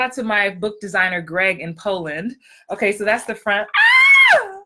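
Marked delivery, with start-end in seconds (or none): 3.8-4.6 high, loud scream of delight; excited scream. Real, not acted. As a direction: scream with pure joy at the cover. Showing her book cover.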